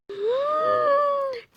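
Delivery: high delighted gasp; a sudden breath in of joy; excited gasp. Real, not acted. Sees the ornament.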